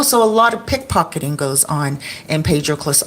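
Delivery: ordinary talk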